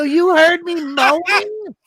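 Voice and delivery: high, drawn-out incredulous question; outraged disbelief. Retold or imitated. Husband confronting wife who heard him, incredulous. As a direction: climb high in aggrieved disbelief.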